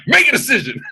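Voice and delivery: high-pitched, sudden yell, played for laughs; mock yelled command. Man from Atlanta running up on a little Brit, mock yell. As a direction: burst out with it as if running up on someone. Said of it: "Imagine if I ran up on you".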